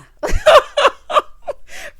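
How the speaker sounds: squealing burst of laughter; high shrieking laugh. Real, not acted. Viewer cracking up at her own joke, shrieking laugh.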